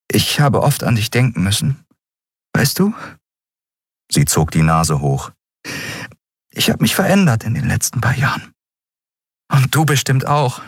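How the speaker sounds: high, fast, tearful voice; pushing through tears; voice breaking. Acted. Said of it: "ihre Stimme brach".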